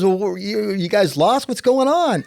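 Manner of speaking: a touch of a high, friendly colonel. Retold or imitated. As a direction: imitate a friendly officer asking, voice lifted. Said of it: "he was a nice guy".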